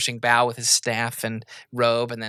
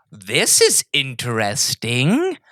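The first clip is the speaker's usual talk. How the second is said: drawn-out, slow regal musing; slow king voice; 4 dB louder, much slower than usual. Acted. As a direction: muse it slowly like a bored king. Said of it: "And he goes".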